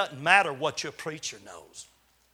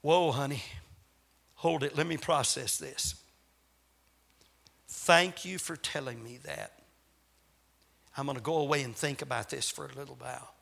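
calm, reassuring parent; 6 semitones lower, 4 dB quieter than usual. Pretend. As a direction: lower and softer, gently taking it in. Parent calmly answers the child.

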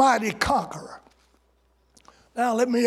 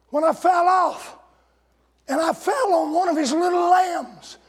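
louder, higher story climax; preached punchline; 5 semitones higher, 3 dB louder than usual. Acted. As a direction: drive home the punchline.

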